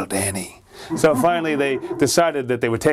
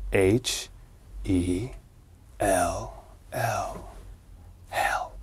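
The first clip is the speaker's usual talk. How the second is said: low, softer, slow letter by letter; ominous spelling; 5 semitones lower, 9 dB quieter, much slower than usual. Acted. The board spelling out its home, low and hushed. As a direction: let each letter drop into the dark.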